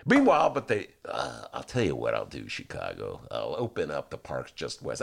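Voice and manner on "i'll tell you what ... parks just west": low, quiet mayoral decree; mock mayor. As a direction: imitate a leader granting a favor, deep and soft.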